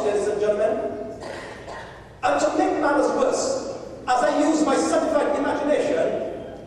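ordinary talk, nothing marked

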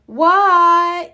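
a high, loud what; yelled. A narrator's reading. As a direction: shout back from outside. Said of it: "yelled Froggy".

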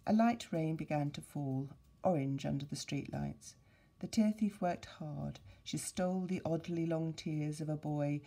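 lively storybook reading voice; story narration. Acted. Teacher reading The Tear Thief aloud to the class, storybook narration. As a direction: read it to the class with a sense of adventure.

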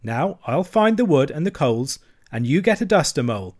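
rally a downhearted friend; cheery bustle. Acted. Rat cheering up gloomy Mole.

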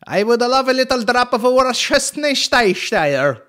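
high, loud, drawn-out garbled sauce name; mock mispronouncing. Joker mangles the sauce name, mock mispronouncing. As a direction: mangle the words loudly in a high comic voice.

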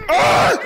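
a sudden high shout at being caught; real scream of surprise; startled shriek. Real, not acted. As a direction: yelp high and loud. Just got tagged.

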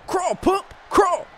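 high urgent yelled commands; excited shout. Real, not acted. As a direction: bark the steps out fast. Urging his character on.

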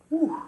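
sharp high exhale, mouth on fire; high whew from spicy heat. Real, not acted.

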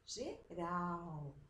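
"Geral" with RAL held long; short GE, stretched RAL in "Geral"; stress on RAL. Shown as a demonstration. Teacher answers which syllable is strong, stressing RAL in "Geral". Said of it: "E a sílaba forte?".

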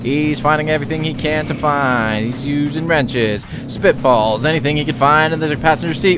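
low, fast announcer patter; race commentator. Pretend. Gamer narrating the race for pretend TV viewers. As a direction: hype the race like live TV.

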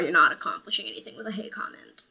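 quick whispered aside; hurried whispered jab at haters; fast whisper. Real, not acted. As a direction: rush it under your breath.

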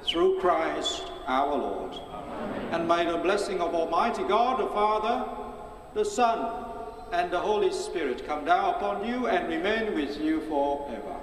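ordinary talk

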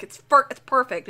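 ordinary talk

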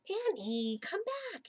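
high-pitched mild mock shout. A narrator's reading. Jack calling Annie back, a restrained shout. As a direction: call after someone high, without real volume. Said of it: "he shouted".

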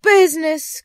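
loud, high outburst; indignant cry. A narrator's reading. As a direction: cry it out as an outraged ghost. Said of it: "cried the ghost".

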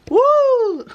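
high, loud "woo"; celebratory whoop. Real, not acted. Vlogger capping her own birthday chant, celebratory whoop. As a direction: let out one long, high party whoop.

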